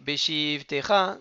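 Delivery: Beshivtecha with the shi drawn out slowly; dragging the hirik. Shown as a demonstration. Teacher shows the wrong slow Beshivtecha on the hirik. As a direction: linger too long on shi in Beshivtecha. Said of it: "non, on fait pas ça".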